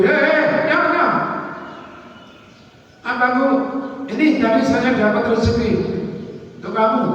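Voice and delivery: high-pitched showy calling out; mock calling out. Preacher showing how not to give alms, calling out. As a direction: act out announcing a gift for all to hear. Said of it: "Jangan teriak-teriak mau beri".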